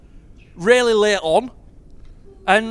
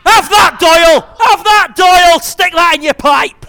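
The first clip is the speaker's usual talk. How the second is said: taunting shout; 6 semitones higher, 12 dB louder, slower than usual. Real, not acted. Taunting rival after goal.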